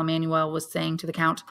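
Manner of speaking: plain reading voice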